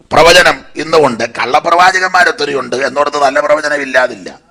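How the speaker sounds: normal talking voice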